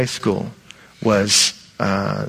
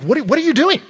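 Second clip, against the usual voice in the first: high, loud burst of alarmed protest; outraged disbelief; 13 semitones higher, 5 dB louder, faster than usual. Acted. Dog owner to a sitter kicking the dog, outraged. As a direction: blurt it out indignantly, stumbling on the first words.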